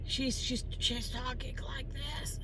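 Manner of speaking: imitated smoker's voice, slow voiceless rasp; terrible smoker's voice. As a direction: mimic a wrecked smoker in a slow whisper. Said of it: "She sounds terrible".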